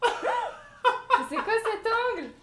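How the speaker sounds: high, loud, laughing question. Real, not acted. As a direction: laugh through the words. Laughing at extreme zoom.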